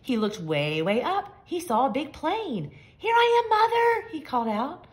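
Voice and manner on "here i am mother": high, loud call up to the sky. A narrator's reading. Baby bird calls to the plane, loud and high. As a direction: shout up to the plane.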